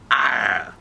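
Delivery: loud, drawn-out playful growl; courage roar. Acted. Courage shows the shy girl her sound, loud growl. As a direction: let out a big hearty "arr". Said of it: "make that sound".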